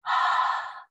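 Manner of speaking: airy unvoiced breath. Shown as a demonstration. Teacher showing the class an unvoiced breath. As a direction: let out pure air to show an unvoiced sound. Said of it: "unvoiced sounds use just air".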